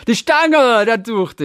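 laughing. Real, not acted. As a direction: burst out laughing in recognition. Delighted recognition with laugh.

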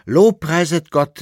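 high, loud call to praise; exultant call. A narrator's reading. Reb Amschel announces the pardon, loud exultant praise. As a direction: proclaim it to the whole cell. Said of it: "rief Reb Amschel".

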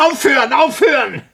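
high and loud, desperate; anguished scream. Acted. Scientist cries out against the haunting voice, anguished scream. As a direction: scream it to drown the voice.

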